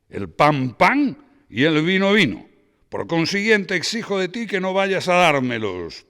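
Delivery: loud, high and overbearing, proud of being frank; boisterous Braulio. Acted. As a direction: proclaim it, leave no room to refuse. Braulio voiced by reader.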